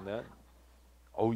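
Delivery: usual speaking voice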